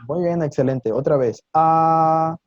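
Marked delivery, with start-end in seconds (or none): 1.5-2.4 "A" held high and level; loud, drawn-out level "A"; first tone. Shown as a demonstration. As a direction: sustain "A" on one high, unwavering note. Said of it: "un tono que va alto y sostenido".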